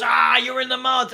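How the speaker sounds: a mild mock jeer at the critics. Host mimicking a gloating commenter, mock jeer. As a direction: sneer it back like a gloating troll. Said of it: "It's just someone scores".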